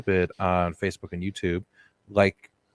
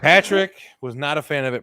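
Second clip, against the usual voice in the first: loud teasing through a laugh; 5 semitones higher, 6 dB louder than usual. Real, not acted. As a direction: laugh while teasing him.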